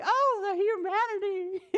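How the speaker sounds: high, hammed-up crying wail; king's men embracing, mock sobbing. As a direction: wail it like a tragedy. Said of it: "and they say".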